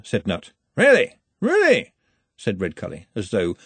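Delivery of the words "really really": very high, loud double take; hearty astonishment, falling off each time; surprised. A narrator's reading. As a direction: exclaim it in jovial amazement. Arch-Chancellor reacts to Nutt's claim.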